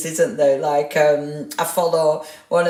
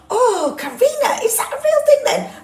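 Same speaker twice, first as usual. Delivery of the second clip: high, quick squeal of disbelief; real amazement, voice way up high; astonished; 4 dB louder than usual. Real, not acted. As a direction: squeak it, half laughing with surprise. Realises she took word for typo.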